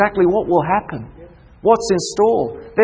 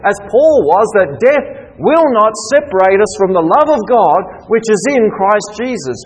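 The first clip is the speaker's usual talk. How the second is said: higher, louder preacher's call; rally the brethren against fear; rousing preaching; 7 semitones higher, 5 dB louder than usual. Acted. Preaching at full force.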